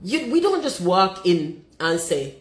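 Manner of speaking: ordinary talk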